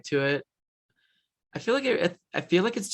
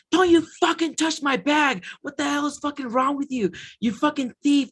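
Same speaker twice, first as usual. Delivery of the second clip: put-on angry girlfriend yelling; 11 semitones higher than usual. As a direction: shriek at him like a furious girlfriend. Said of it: "She goes".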